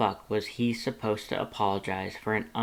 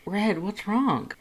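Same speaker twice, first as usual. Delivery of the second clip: higher, slow, concerned partner; Lust's voice; 10 semitones higher, slower than usual. A narrator's reading. Lust asking Red what's wrong, worried higher voice. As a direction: ask gently but worried.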